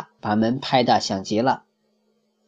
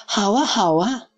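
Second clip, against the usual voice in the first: put-on delighted childlike chirp, much higher; eager yes from the little wolf; happy; 9 semitones higher than usual. A narrator's reading. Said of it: "笨狼高兴地说".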